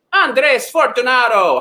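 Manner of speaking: high, slow, rolling flourish on the name; showy name announcement. Shown as a demonstration. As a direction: relish the name like a grand announcer. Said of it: "say that name like that".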